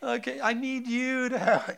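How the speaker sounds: put-on high pleading little-girl voice; whiny child. Father mimicking daughter begging for help with toothbrush. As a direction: beg for help like a small kid.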